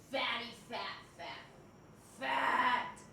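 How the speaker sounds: ordinary delivery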